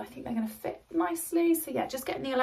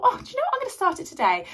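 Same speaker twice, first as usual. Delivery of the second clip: bright, much higher burst of sudden resolve; excited thought; 10 semitones higher, 4 dB louder, faster than usual. Retold or imitated. Deciding alone at home to start today, excited. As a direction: perk up as the idea strikes. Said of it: "And I thought".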